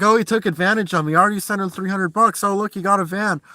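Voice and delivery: high, fast whiny mockery of a hater; mocking the troll. Vlogger mimics the troll who called him a user, whiny mockery. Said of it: "this guy was like".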